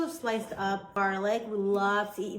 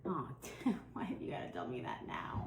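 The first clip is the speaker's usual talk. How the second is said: whispered dismay; 8 semitones lower, 8 dB quieter than usual. Real, not acted. Feigned dismay at meat news.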